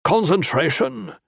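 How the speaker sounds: scornful question, pitched high; scornful echo. A narrator's reading. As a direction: throw the word back with scorn. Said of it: "mocking repeat by Tan".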